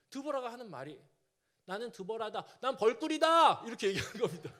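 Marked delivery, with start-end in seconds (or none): 1.6-3.6 loud declaration. Retold or imitated. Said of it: "아, 벌꿀?".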